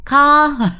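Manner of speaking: blunt, loud repeated syllable; rude-sounding. Shown as a demonstration. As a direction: punch out each syllable loudly, a little high. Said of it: "It sounds rude already".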